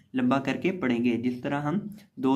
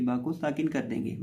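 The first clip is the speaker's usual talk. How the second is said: ordinary talk, nothing marked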